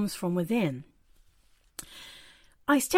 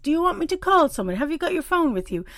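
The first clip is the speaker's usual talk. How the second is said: higher, fussing reassurance as the guard; mother her, higher and kind; woman guard calming the weeping passenger, higher and fussing; soothing woman; 8 semitones higher than usual. Retold or imitated. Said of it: "she was like".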